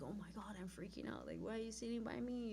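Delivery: softly muttered, retold worry; hushed inner thought. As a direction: think it under your breath.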